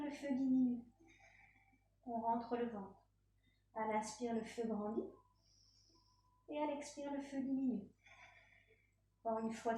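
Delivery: low, calm yoga-teacher voice with long pauses; guided breathing meditation. Real, not acted. Yoga teacher guiding breaths in downward dog, calm guided meditation. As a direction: lead each breath in and out, gently.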